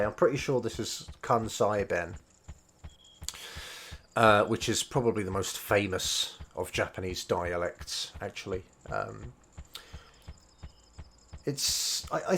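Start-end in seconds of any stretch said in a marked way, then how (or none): none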